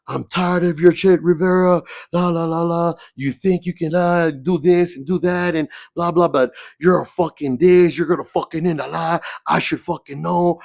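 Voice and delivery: high, loud mock rant of the principal; angry principal. Assistant principal scolding a student, high loud mock rant. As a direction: bark it like a fed-up principal scolding. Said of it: "he's like".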